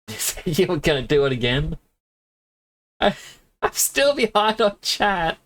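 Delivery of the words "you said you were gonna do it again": ordinary talk, nothing marked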